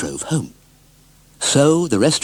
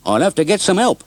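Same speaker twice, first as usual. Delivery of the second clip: higher, louder friendly farmer voice; helpful farmer; 8 semitones higher, 3 dB louder than usual. Acted. Farmer Hearn offering Bill help, higher friendly voice. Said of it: "said Farmer Hearn".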